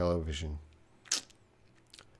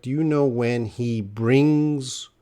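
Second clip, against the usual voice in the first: slow down and lengthen "brings", landing its final S; stress on brings; 2 semitones higher, slower than usual. Shown as a demonstration. Teacher correcting Christina's sentence, stress on "brings". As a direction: hit "brings" with a clear final S. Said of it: "You need the S, brings".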